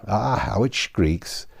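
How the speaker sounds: put-on 1 grumbling old man. Old Joe at the creaking door.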